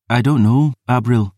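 lightly voiced maid; weary maid. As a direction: answer drained and haggard. Isla's voice.

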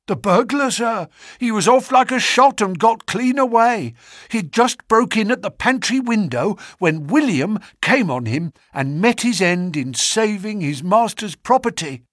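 high, urgent servant's voice, gasping out the news; a shaken butler bursting in; breathless butler. A narrator's reading. Butler tells of the burglar.

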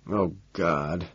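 low, soft moan of discomfort; pained moan. A narrator's reading. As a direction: moan quietly as cramps come on. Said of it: "I moan, sensing more cramps".

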